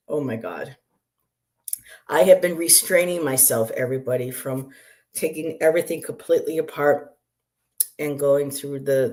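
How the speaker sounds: normal talking voice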